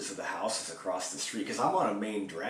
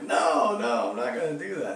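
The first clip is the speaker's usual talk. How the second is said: high, loud frantic no; retelling a yelled no to a cop; panicked denial; 7 semitones higher, 10 dB louder than usual. Retold or imitated. Denying cop's accusation.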